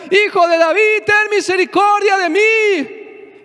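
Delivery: desperate shout, pitched high; shouting for mercy. Retold or imitated. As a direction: cry out to be heard over the crowd. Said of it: "empezaron a gritar".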